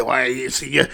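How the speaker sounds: low, hesitant grumble of a critic; imitated objector. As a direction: mimic a naysayer, pitched well down. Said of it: "some people say".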